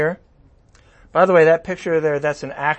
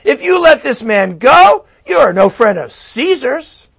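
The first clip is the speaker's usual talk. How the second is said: shouting crowd; 9 semitones higher, 10 dB louder than usual. Acted. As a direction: shout the threat down at Pilate. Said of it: "kept shouting".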